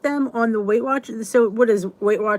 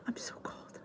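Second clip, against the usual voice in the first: breathy hush, barely there; cold whisper; 22 dB quieter than usual. Real, not acted. Whispering to viewers about being cold.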